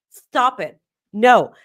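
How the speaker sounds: ordinary talk, nothing marked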